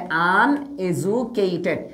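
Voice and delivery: "Uneducated" very slowly, piece by piece; slow word modelling. Shown as a demonstration. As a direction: stretch out "uneducated" with gaps between its parts. Teacher models word for note-taking. (খুব ধীরে ভেঙে ভেঙে "uneducated")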